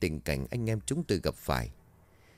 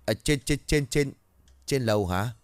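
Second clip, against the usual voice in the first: higher, stuttering in fright; Mạnh stammering to the guard in fright; terrified stammer; 4 semitones higher than usual. Acted. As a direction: trip over every word in fear. Stammering terrified young man.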